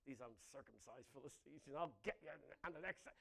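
low, quick muttered threat. Retold or imitated. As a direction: grumble it under the breath, fast. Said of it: "He's not, like, saying".

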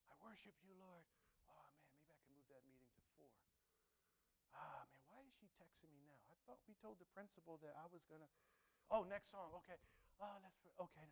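half-listening worshipper muttering; distracted mumble. Preacher mimicking his own distracted worship, quiet mumble. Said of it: "but it's like".

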